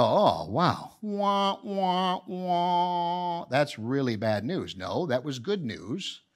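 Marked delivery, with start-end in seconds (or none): 0.0-4.8 higher, hammed-up "wah, wah, wah" of fake crying; mock wailing. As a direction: pretend to whine over bad news. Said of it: "And you might go".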